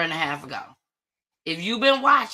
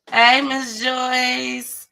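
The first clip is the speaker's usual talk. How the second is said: loud, higher, very drawn-out hello; calling out greeting; 6 semitones higher, 6 dB louder, much slower than usual. Real, not acted. Host greeting Miss Joyce in the audience, called out. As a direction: sing out the greeting, stretching it long.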